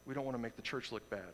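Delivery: soft, hushed mock plea to be quiet; a hushing, dismissive mock whisper. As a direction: shush them softly, imitating the church. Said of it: "they were saying to those people".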